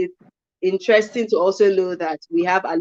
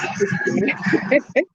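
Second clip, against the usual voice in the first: light rising giggle; giggling laugh; 5 semitones higher than usual. Real, not acted. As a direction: laugh it off mid-sentence. Breaks into laughter.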